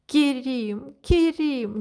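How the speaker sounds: high rising cry in delight and fear. A narrator's reading. The princess cries out to Kerim in delight and fear. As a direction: call his name, pitch soaring. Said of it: "не своим голосом ... закричала я".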